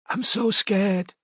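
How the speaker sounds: high, soft, slow trembling whisper; frightened girl whispering down the phone; terrified whisper. A narrator's reading. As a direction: whisper it as if someone might hear. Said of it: "whispered the girl".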